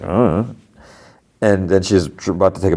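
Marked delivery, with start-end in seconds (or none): 0.0-0.4 noncommittal little "uh"; a brief dismissive shrug of a sound; shrugging. Retold or imitated. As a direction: imitate a verbal shrug. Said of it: "shrugs, like".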